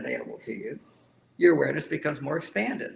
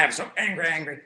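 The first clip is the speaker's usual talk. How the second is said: mock tough-guy anger. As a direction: puff up and bark like a tough guy. Mocking an angry tough guy.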